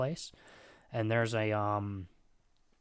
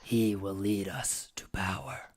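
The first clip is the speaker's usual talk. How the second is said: demon whispers; 3 semitones lower, 3 dB louder than usual. Acted. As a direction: breathe the orders like a lurking presence. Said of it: "they say".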